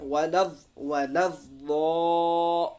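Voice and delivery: "वलव वो" with the last vowel held very long; long madd. Shown as a demonstration. Teacher demonstrates the madd, "वो" stretched long. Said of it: "खींच कर पढ़ना है".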